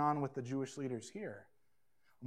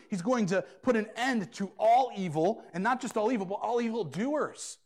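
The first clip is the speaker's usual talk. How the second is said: rising, louder preaching push; preaching build; 5 semitones higher, 6 dB louder than usual. Acted. Pastor warns the congregation of God's end to evil, building preaching. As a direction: drive the judgment home from the pulpit.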